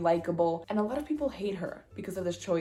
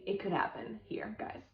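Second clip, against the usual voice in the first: secretive unvoiced whisper; 7 dB quieter than usual. Real, not acted. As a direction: lean in and whisper it to the viewers. Conspiratorial aside to viewers.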